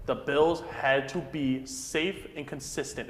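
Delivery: ordinary talk, nothing marked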